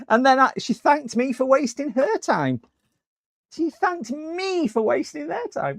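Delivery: high, squeaky indignation; mock disbelief. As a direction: repeat it as if scandalised, pitched right up. Mock disbelief at caller's parting thanks.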